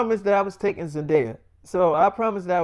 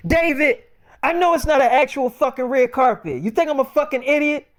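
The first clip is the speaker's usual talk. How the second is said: put-on high-pitched offended yell at her boyfriend; mock outraged yell; 7 semitones higher, 5 dB louder than usual. Sick girlfriend snapping at her boyfriend over the phone, high offended yell. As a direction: throw the insult back at him, pitched way up. [name] insulted, yelling.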